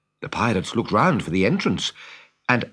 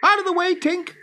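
high, sharp warning shout; urgent shout; 3 dB louder than usual. Acted. Tootles warns the fairy before shooting, shouting. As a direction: call out high to clear the way. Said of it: "he shouted".

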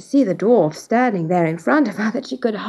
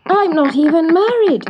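high-pitched dismayed cry; cried out; 7 semitones higher, 4 dB louder than usual. A narrator's reading. Eloise protests to the dwarf, crying out in dismay. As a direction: protest in a much higher voice. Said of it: "cried Eloise".

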